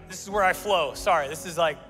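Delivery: normal talking voice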